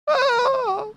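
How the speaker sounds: sudden shrill yelp of surprise; high yelp. Real, not acted.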